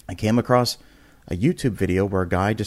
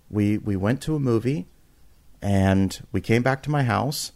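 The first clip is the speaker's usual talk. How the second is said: ordinary talk, nothing marked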